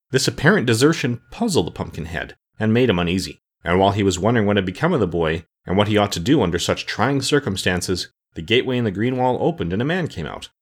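ordinary delivery

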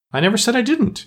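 high-pitched, indignant interruption; protesting. A narrator's reading. Alice interrupts the Mock Turtle's story, protesting. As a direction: jump in high to object.

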